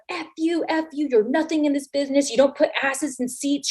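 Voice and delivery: high-pitched mock outburst; imitated angry rant. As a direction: mimic a wrestler ranting in your face. Wrestler rants in her face.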